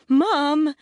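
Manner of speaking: high, loud cry of panic; high-pitched terror. A narrator's reading. Son panicking at his mum, high-pitched with terror. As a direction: call out in a terrified, high voice. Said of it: "His voice has gone all high-pitched with terror".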